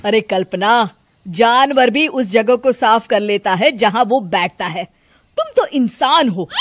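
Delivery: lightly voiced husband, loud and reproachful; husband Ashok scolding. A narrator's reading. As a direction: scold your wife about her messy room. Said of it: "Ashok उससे कहता है".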